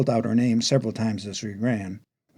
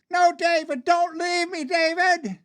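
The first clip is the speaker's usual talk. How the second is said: very high, wailing plea; desperate begging. Acted. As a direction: plead in terror of being left alone. Sister begs not to be left.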